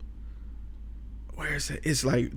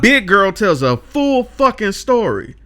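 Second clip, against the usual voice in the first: big booming hype intro; announcer voice; 8 semitones higher, 6 dB louder than usual. Pretend. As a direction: announce it like a trailer. Hyped announcer-style intro.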